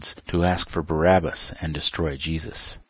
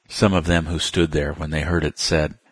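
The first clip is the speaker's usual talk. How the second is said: plain reading voice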